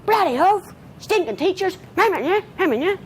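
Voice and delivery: put-on high-pitched, indignant kid rant; mock outburst, pitched right up. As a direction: imitate an aggrieved schoolkid going off. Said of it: "what the Jimmies of this world will do is go".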